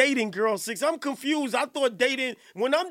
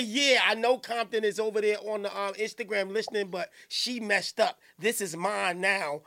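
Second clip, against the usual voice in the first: mocking quote; 7 semitones lower, 4 dB quieter than usual. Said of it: "you got the nerve to say".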